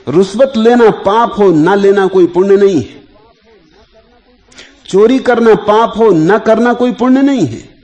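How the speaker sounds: high, loud pronouncement; emphatic preaching. Real, not acted. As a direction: lay down each maxim forcefully, with pauses between. Discourse rising to full force.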